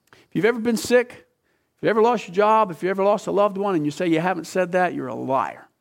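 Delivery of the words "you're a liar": ordinary talk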